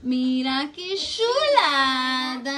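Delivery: very slow, stretched mira qué chulada; drawn-out admiring. Real, not acted. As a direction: savor every word of admiration. Admiring finished soup.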